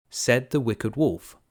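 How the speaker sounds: ordinary delivery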